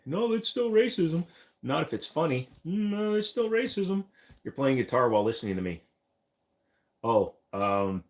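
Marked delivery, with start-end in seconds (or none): 0.0-4.0 high put-on voice. Voiced second party mock.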